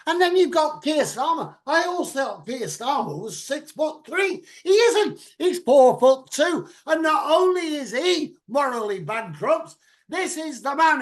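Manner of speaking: high, loud indignant rant; worked-up political rant. Real, not acted. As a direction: rail against him, loud and high. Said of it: "I was just having a rant".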